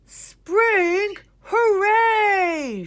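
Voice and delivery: very high, loud cheering shout; joyful shout. A narrator's reading. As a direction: cheer like a thrilled crowd. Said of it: "shouted the reporters".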